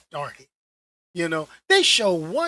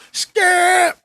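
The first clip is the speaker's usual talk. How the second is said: high, loud shout of Skip; Shannon Sharpe yelling; 11 semitones higher, 11 dB louder than usual. Retold or imitated. Calling out to his co-host, loud high shout. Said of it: "I'm looking like Shannon Sharpe".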